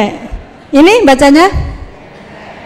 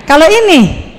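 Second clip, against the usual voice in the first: ordinary talk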